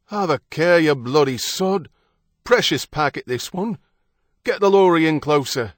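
loud, high British docker's calls; British dock worker accent. A narrator's reading. Dock workers moving the chamber, shouting in British accents. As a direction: holler orders in a rough British docker accent. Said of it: "The accents of British dock workers".

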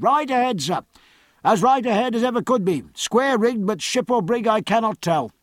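high, carrying shout from aloft; high-pitched sailor's report, called down; lookout's hail. A narrator's reading. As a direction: sing out the sighting to the deck. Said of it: "the lookout answered".

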